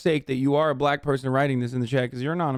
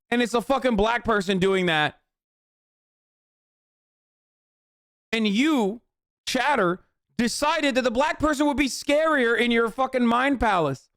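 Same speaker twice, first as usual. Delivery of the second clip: raised, pitched-up angry rant at chat; heated rant; 6 semitones higher than usual. Real, not acted. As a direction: tear into the chatter, voice climbing high. Ranting on at the chatter.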